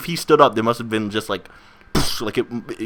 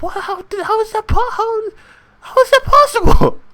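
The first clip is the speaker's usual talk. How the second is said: put-on squeaky, high astonished kid; high-pitched baffled child; very high disbelief; 5 dB louder than usual.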